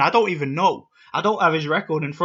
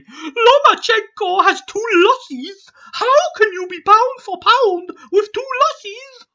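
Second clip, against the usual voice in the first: much higher, slow, whiny mock of a troll; 7 dB louder, slower than usual. Vlogger mocking troll comments in a high nasal-cartoon voice. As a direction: read the comment like a snotty cartoon genius. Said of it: "the reason I do that voice when I read out comments".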